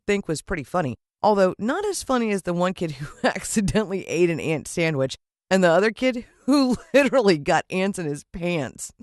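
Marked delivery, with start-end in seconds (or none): 1.2-8.9 chuckling through the words; laughing. Real, not acted. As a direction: giggle as you tell it. Laughing while telling.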